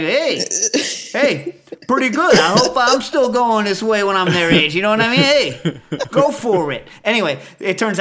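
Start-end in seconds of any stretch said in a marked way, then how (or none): 0.0-4.7 higher, louder impressed cheer; a hint of a stepfather cheering someone on; lightly voiced stepfather impressed by the noises; stepfather's joke. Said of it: "my stepfather was like". 5.2-6.9 a hint of a stepfather cheering someone on; lightly voiced stepfather impressed by the noises; stepfather's joke. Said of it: "my stepfather was like".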